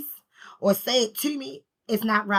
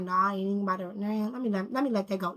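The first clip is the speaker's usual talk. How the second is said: dismissive inner voice; 4 semitones lower, 10 dB quieter, faster than usual. Retold or imitated. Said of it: "will come to us and be like".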